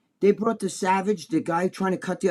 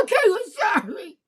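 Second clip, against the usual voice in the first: much higher, shouted mock apology; mock surrender; 14 semitones higher than usual. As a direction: yell it like a scared kid giving up. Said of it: "They'll be fucking".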